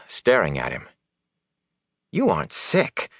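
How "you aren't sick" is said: high-pitched disbelief; incredulous. A narrator's reading. Matt staring at Rand, incredulous. Matt astonished at Rand's behaviour.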